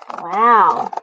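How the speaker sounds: plain reading voice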